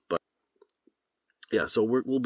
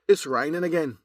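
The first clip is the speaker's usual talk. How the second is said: a lilting Irish accent, pitched higher and louder; 9 semitones higher, 5 dB louder than usual. Shown as a demonstration. Voice actor shows his Irish accent for the camera, lilting Irish. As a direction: put it in a lively Irish accent, said forward in the mouth. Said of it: "Now from Ireland".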